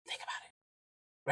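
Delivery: slow, hushed whisper; whispered prompt. Shown as a demonstration. Urging fellow drivers to reflect, whispered. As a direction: whisper it slowly, letting it sink in.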